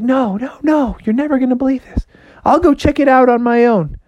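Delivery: put-on high, excited kid-frog voice; eager insisting. Froggy insisting to mom about the beans, eager. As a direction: protest eagerly in a high little voice.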